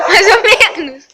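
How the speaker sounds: laughing through the words. Real, not acted. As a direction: giggle it out between bites. Laughing while eating onion.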